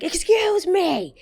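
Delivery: very high, drawn-out shriek; retold furious yell. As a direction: yell it long and high, outraged. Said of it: "I just yelled at her to be like".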